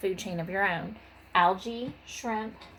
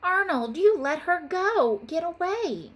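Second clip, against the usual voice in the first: mock scolding; 9 semitones higher, 4 dB louder than usual. Wanda accusing Arnold of losing Bella, high mock scold. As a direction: blame him, hammed up for kids.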